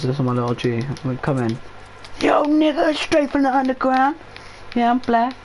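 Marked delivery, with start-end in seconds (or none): 2.2-5.4 high hyped-up street voice; hyped gangster voice. Pretend. Put-on gangster persona.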